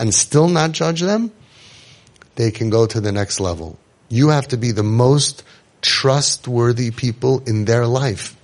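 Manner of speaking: usual speaking voice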